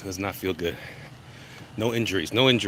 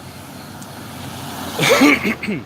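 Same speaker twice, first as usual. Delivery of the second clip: a raspy chesty cough to show the phlegm; demo cough; 12 semitones higher, 5 dB louder than usual. Shown as a demonstration. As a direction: cough it out hard to prove the point.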